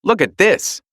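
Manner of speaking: high, eager call; boy spotting tracks; excited shout. A narrator's reading. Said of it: "he suddenly shouted".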